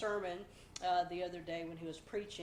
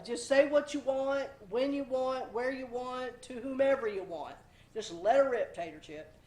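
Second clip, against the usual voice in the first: mock careless attitude; 4 semitones higher, 6 dB louder than usual. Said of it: "let her rip tater chip mentality".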